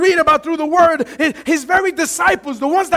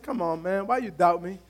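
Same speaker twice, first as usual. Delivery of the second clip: much lower and softer, easygoing; Jesus calmly teasing his disciples; 8 semitones lower, 10 dB quieter than usual. Retold or imitated. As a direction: gently chide them. Jesus answers calmly, low.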